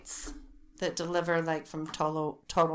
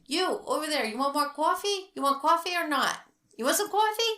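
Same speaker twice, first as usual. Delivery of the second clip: higher, louder imitation of a waitress yelling; mock yell across diner; 8 semitones higher, 4 dB louder than usual. Retelling the waitress yelling coffee offers across the diner. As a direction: call out across the room, rising higher each time. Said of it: "yelling across the diner".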